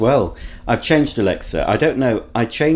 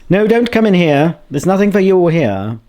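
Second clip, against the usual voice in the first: calling out; 7 semitones higher, 5 dB louder than usual. Real, not acted. Shooing someone from the kitchen.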